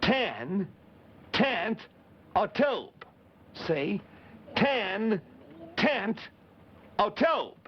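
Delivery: each part apart with pauses; Ten, tent, a tube, one piece at a time; said slowly. Shown as a demonstration. Teaching Clarabelle the name, piece by piece. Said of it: "listen to it slowly".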